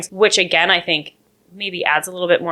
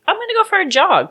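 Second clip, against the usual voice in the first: high breezy mock casual; 13 semitones higher, faster than usual. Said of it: "just being like".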